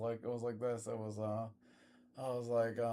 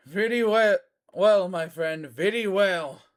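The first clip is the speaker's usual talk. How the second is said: villain's dismissal; 5 semitones higher, 7 dB louder than usual. Acted. Villain closing line.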